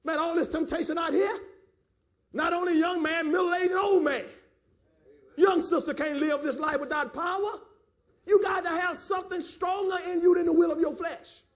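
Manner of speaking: impassioned sermon cry; fervent preaching. Real, not acted. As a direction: press it on the congregation with force. Preaches at full force.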